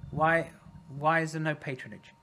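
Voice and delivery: ordinary talk, nothing marked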